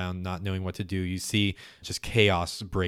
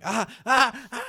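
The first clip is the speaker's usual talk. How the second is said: high, loud mock screams; short panicked yelps of a crowd; 6 dB louder than usual. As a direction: imitate a crowd yelling in alarm. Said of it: "screams and yells".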